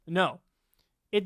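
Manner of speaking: ordinary delivery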